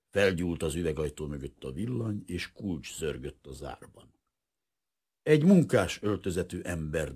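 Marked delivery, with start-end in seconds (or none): none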